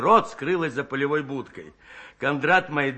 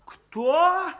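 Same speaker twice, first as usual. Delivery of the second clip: astonished; 13 semitones higher than usual. A narrator's reading. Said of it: "изумились бабы".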